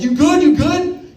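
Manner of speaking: high, loud panicked check-in; mock-panicked high voice; frantic concern. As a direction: rush over asking if he's okay. Worried about the crashed kid.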